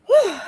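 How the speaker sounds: high, breathy exhale of relief; relieved whew. Real, not acted. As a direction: blow out a tired whew after lifting. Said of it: "take a breath".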